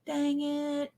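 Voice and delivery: a high, soft, whiny "Dang it"; Woody's "Dang it". Retold or imitated. Showing how Woody from Sweet Life on Deck says it, high and soft. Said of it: "didn't say it like Woody on Sweet Life on deck".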